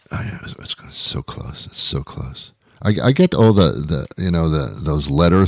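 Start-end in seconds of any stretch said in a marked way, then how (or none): 0.1-2.5 very soft, low aside; sheepish mutter. Pretend. Comic excusing a mix-up of SUV and SVU, sheepish mutter. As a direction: mumble it almost under your breath.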